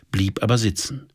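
usual speaking voice

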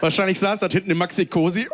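ordinary talk, nothing marked

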